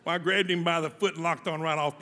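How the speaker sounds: usual speaking voice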